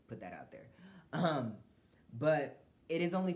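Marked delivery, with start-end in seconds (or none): 0.1-0.7 whispered aside. Real, not acted. Aside to close a defensive rant.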